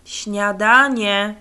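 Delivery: long, loud drawn-out call; mother calling loudly to breakfast. A narrator's reading. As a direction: call the boy from the kitchen. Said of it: "zawołała matka".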